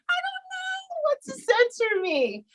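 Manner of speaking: exasperated high-pitched complaint; mock frustration. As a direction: squeal it in playful frustration at your coach. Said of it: "I'm getting frustrated now".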